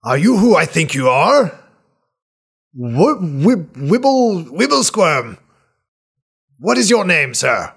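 put-on high, loud, eager old man; bursting with recognition; excited old healer. GM voices startled NPC.

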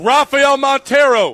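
loud, drawn-out emcee call; calling out a name. Real, not acted. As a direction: throw the name out to the crowd. Calling names for crowd applause.

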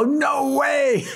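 stunned into a yelp; high disbelieving shout. Retold or imitated. Guitarist hearing it is [name], shouting in disbelief. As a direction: cry out "No way" in a high pitch.

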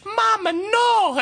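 high, slow squealing whine; high drawn-out mock teen wail; daughter protesting. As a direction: stretch it out high like a mortified teen. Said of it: "And she says".

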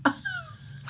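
high giggle at herself; laughing. Real, not acted. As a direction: burst out laughing. Laughs at own accent.

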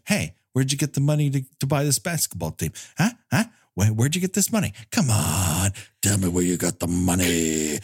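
insistent interviewer imitation, dropping low at the end; mock grilling. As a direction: play a pushy reporter grilling someone. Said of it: "he grills him like he's running for president".

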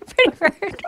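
high squawky parrot through a laugh. Pretend.

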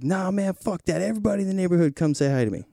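high, fast scoffing neighbor voice; dismissive neighbor. Retold or imitated. Retelling the neighbor dismissing him, high, fast and scornful. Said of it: "he was like".

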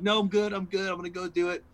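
high, insistent brush-off; stressed refusal. Retold or imitated. Nervous passenger declining driver's offer, high and insistent. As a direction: wave the offer away, loud start then easing.

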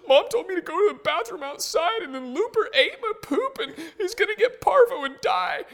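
high falsetto imitation of a sobbing son; gasp, then wail it like an upset kid; bawling child. Son telling dad what happened to the puppy, mock bawling. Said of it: "and he's bawling".

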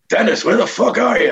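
high-pitched impatient call from upstairs; George Carlin. Retold or imitated. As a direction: imitate a boss hollering for someone out of sight. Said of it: "George's voice going".

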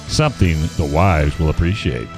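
ordinary talk

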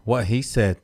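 usual speaking voice

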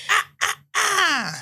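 put-on high baby babble; baby Kevin voice. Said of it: "This is my baby Kevin voice".

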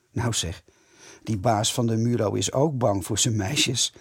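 plain reading voice

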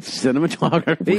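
teasingly insistent query; playful pestering. Real, not acted. Playfully pestering co-host about award.

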